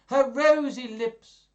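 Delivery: plain reading voice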